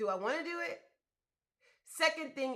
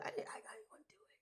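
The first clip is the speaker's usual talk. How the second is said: hushed, muttered under the breath; a quiet grudging whisper; whispered reluctance; 13 dB quieter, faster than usual. Retold or imitated. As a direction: whisper it like you don't want to. Voicing reluctant self, whispered.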